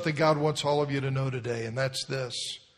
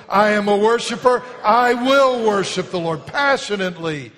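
loud proclaiming; 7 semitones higher, 10 dB louder than usual. Shown as a demonstration. Pastor rallies congregation, loud fervent declaration. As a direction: belt it out as a declaration. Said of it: "Here we go".

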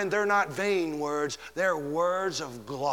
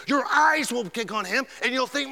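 ordinary delivery

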